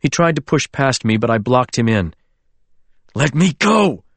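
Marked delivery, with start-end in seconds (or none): 3.1-4.0 angry. Acted. Drunk friend struggling to leave.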